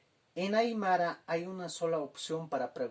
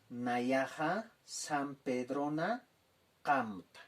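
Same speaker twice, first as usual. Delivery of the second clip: slow, careful Aymara model answer; teacher modelling Aymara slowly; slower than usual. Shown as a demonstration. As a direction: give the answer slowly for repetition. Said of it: "La respuesta es".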